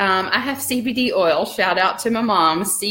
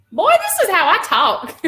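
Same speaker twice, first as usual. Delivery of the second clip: Southern honky tonk; 12 semitones higher, 5 dB louder than usual. Retold or imitated. Telling her husband Jeremy off in a thick Southern accent. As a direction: play up the Alabama twang through a laugh. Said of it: "my honky tonk comes out more".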